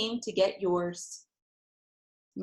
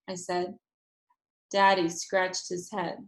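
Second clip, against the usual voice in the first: ordinary delivery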